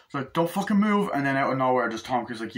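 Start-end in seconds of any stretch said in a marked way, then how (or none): 0.3-1.1 high-pitched imitated warning; urgent command. Retelling cop holding the driver, urgent command. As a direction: freeze the suspect with a raised voice.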